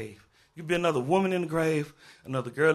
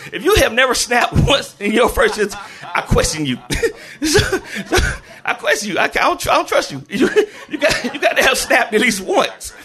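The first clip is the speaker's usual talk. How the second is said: raised, loud voice breaking into laughs; laughing teasing; 7 semitones higher, 7 dB louder than usual. Real, not acted. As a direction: tease through your own laughter. Teasing audience while cracking up.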